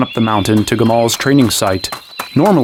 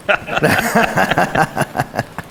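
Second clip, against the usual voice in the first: breathy laugh; 7 semitones higher, 4 dB quieter than usual. Acted. Laugh after stop fussing.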